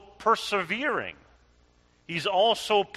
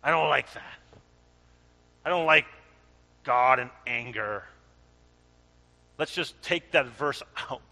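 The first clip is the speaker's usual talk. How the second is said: mock complaint; 4 semitones lower, faster than usual. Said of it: "some of the people will say".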